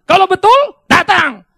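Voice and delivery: loud urging. Real, not acted. Preacher urges congregation.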